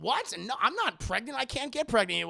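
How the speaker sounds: high, insistent put-on protest; imitated flustered patient; mock shocked denial. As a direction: protest in rising disbelief. Acting her shocked denial.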